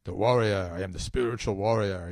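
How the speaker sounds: lightly voiced warrior, slow and drawn out; forceful spiritual warrior. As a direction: declare it slowly, like a self-styled warrior. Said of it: "forceful way".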